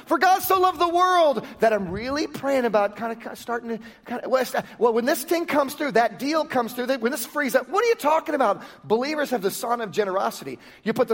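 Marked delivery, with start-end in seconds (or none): 0.1-8.6 hammed-up pulpit parody; mock scripture. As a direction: ham up the verse as a hesitant giver. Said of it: "parody of a stingy giver".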